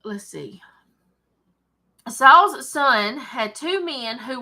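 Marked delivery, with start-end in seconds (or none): 0.0-0.6 soft whispered aside. Real, not acted. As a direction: breathe it out under your breath. Quiet aside while finding her place.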